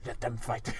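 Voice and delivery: low, soft sinister villain murmur; a movie villain muttering his order with a hint of menace; villain line. In the zoom on his face, a villain mutters quietly. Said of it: "and he's like".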